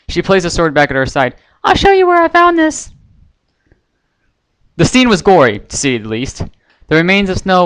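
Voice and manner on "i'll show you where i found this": very high, bright cartoon pony voice; high squeaky cheerful pony girl; Pinkie Pie voice. A narrator's reading. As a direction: chirp it like a giddy little pony.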